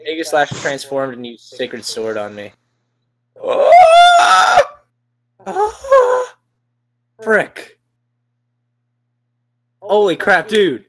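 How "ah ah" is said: high, loud shriek of dismay; shocked scream. Real, not acted. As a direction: scream in sudden despair. Pokemon killed in battle.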